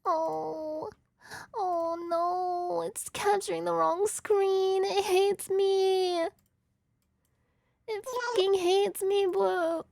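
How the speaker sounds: mock-tearful moaning; whiny despair. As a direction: wail as if the game has it in for you. Whining that game hates them.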